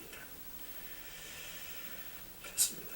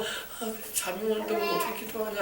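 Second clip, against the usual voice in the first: acting out drowsy disciples, soft and weak; sleepy, under-the-breath complaint; tired, sleepy grumble; 12 dB quieter than usual. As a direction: mumble it softly as if dozing off. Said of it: "막 피곤해가지고".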